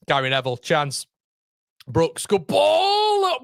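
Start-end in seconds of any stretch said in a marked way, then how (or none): 1.9-3.4 high, slow, stretched-out shout; excited cry. Real, not acted. Fan reacting to a great pass with a stretched-out high shout. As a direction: ride the moment, drawing it out loud. Big chance in the match.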